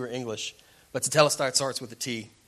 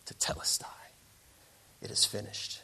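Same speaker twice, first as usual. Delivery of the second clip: whispered, softer awe; hushed satisfaction; 6 dB quieter than usual. Acted. Sculptor steps back from the perfect masterpiece, hushed whisper. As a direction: whisper it to yourself over finished work.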